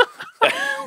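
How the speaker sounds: shrill burst of laughter; high squealing laugh. Real, not acted. Laughs at the accent teasing.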